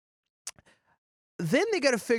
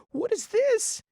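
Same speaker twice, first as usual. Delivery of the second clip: put-on much higher, wide-eyed little girl asking; lightly voiced curious kid, pitched up high; slower than usual. Little girl steps onto planet.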